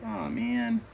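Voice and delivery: normal talking voice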